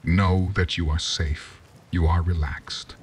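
ordinary delivery

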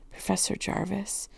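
soft, hesitant address; shaky. A narrator's reading. Girl timidly addressing the old professor, shaky. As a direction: say it softly, a touch unsteady. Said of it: "I said shakily".

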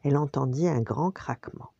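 plain reading voice

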